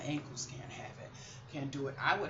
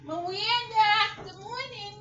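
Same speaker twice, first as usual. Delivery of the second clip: high, slow doll greeting; sing-song greeting; 10 dB louder, slower than usual. Acted. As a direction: coo good morning to the doll. Greets her doll Miranda.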